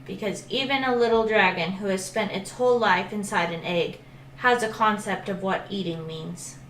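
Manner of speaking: ordinary delivery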